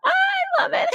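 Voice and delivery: very high laughing cry; laughing shriek. Real, not acted. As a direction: squeal it while laughing.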